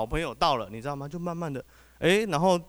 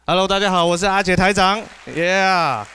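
hyped intro; 5 semitones higher, 6 dB louder than usual. Real, not acted. Hyped stage self-introduction.

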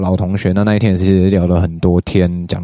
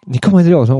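higher, louder mock girlfriend voice; imitating suspicious girlfriend; 10 semitones higher, 4 dB louder, much faster than usual. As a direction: play her suspicious and puzzled. (模仿女友起疑地问，声音更高更大)

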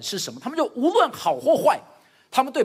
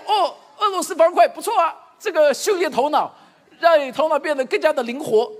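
louder, laughing over-the-top praise; mock cheerful praise; 5 semitones higher, 6 dB louder than usual. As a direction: praise the game as if it were great, hamming it up. (笑着夸张地称赞)

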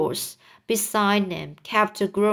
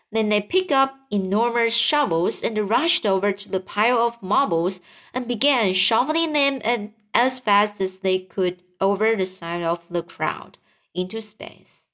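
ordinary delivery, nothing marked

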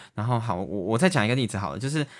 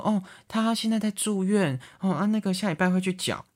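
put-on young woman faking mom; 8 semitones higher than usual. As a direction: play a girl pretending to be her own mom. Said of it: "声音听起来就二十几岁的声音". (年轻女生假装是妈妈的语气)

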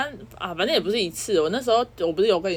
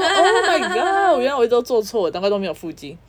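dismayed exclamation; 3 semitones higher, 4 dB louder than usual. Retold or imitated. Telling a friend about finding out her plank was wrong, laughing mock despair. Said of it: "我说".

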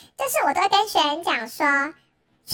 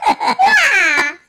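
loud laughing note, high; mock the stuck note while laughing; laughing mimic; 4 semitones higher, 9 dB louder than usual. (边笑边学卡住的音)

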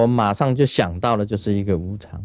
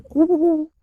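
high-pitched whooshing "woo-woo"; imitated scurrying whoosh. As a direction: imitate a squirrel darting past with a high hoot. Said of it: "那个动作就是这样".

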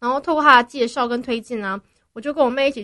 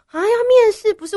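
startled; 10 semitones higher, 7 dB louder than usual. Real, not acted. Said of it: "我就觉得，啊，糟".

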